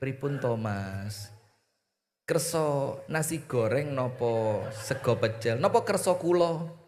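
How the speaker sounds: soft, tender offering; a wife softly asks her husband what he would like to eat; gentle sweet talk. Acted. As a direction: ask him sweetly and softly. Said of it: "sing ngelembe".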